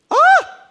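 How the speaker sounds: shrieking mock cry of shock; loud high exclamation. Barber guy exclaiming.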